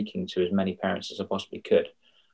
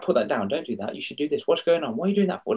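rising nagging parent lines, each one higher; 6 semitones higher, faster than usual. Retold or imitated. Parent scolding a child day in, day out, in a nagging rising voice. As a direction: reel off the same old scolding. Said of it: "in the same tone of voice".

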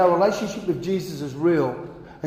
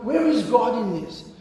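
frustrated questioning; 7 semitones higher than usual. Retold or imitated. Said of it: "It just seems like".

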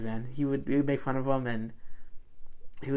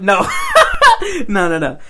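laughing no; 13 semitones higher, 11 dB louder than usual. Real, not acted. Laughing denial.

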